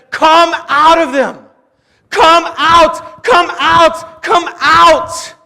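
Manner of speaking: high, loud impassioned sermon; fervent preaching. Acted. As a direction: preach the call to return at full force. Preaching climax at full force.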